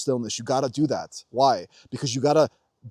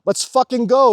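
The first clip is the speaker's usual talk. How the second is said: louder, higher hyped yes; pumped louder, higher battle cry; mock eager cheer; 7 semitones higher, 7 dB louder, slower than usual. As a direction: cheer it on like you're ready for anything. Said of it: "You're like".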